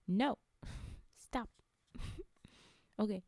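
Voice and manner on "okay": plain reading voice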